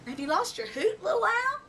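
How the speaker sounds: gentle. A narrator's reading. Big owl comforts crying owlet.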